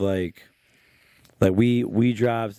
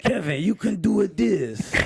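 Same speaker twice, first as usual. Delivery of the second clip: a hint of a wise martial arts master; calm master's pep talk in a put-on accent; kung fu master Chinese accent; 7 semitones higher than usual. Shown as a demonstration. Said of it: "I talk like I'm a kung fu master with a Chinese accent".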